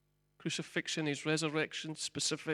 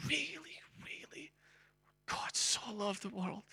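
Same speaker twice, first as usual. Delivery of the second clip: soft, breathy-free unvoiced whisper, much softer; God's whisper; 6 semitones higher, 6 dB quieter than usual. God speaking tenderly to the lost soul, hushed whisper. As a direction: whisper it like a grieving father. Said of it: "And God said".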